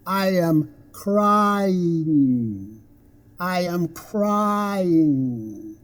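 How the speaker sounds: very slow, "I" and "crying" stretched out; gliding diphthong. Shown as a demonstration. Teacher models a sentence for learners, "crying" slowly elongated. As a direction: draw out the glide in "crying". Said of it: "It kind of glides".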